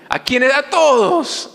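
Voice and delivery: high call and answer; rising preaching cry. Acted. Preacher asking and answering the congregation, pitch soaring. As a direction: soar on the answer.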